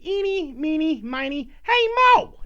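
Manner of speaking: high, loud crazy-cop chant; manic sing-song rhyme. Retold or imitated. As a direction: chant it like a madman about to shoot. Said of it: "And then he goes".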